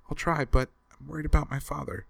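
put-on high, urgent young girl; worried girl pleading quickly; frightened daughter telling her rescuer she fears for her father. A narrator's reading. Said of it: "said the girl urgently".

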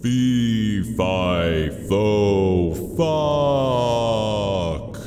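drawn-out booming chant; falling giant. Acted.

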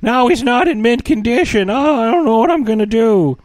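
high, loud, mock-distraught teen; Hiccup. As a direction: whine in squeaky-voiced exaggerated dismay. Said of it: "He's like".

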